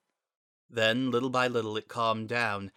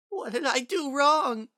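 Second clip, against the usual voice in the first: put-on very high, louder child voice crying out; a frightened boy crying out in despair; 5 dB louder than usual. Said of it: "he cried".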